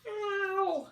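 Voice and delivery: squeaky high cry of pain; high bug cry. Acted. Parent reading to a child, high squeaky bug yelping. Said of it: "cried the smelly little bug".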